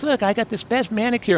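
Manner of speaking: a hint of a proud woman showing off; woman's voice.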